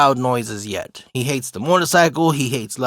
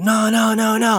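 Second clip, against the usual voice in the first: high, sustained wail of no; imitated freak-out; 7 semitones higher than usual. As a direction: mimic a panicking kid's high protest. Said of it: "he's just freaking out like".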